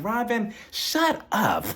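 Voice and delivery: high, sassy put-down; host to Robin, high and sassy; mock shut-down. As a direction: snap it like you're done with her. Telling Robin off.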